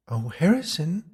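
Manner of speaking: high, lilting ghost woman; ghostly female voice. A narrator's reading. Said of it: "a female voice whispered in the dark".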